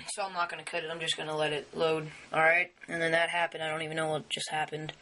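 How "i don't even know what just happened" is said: ordinary talk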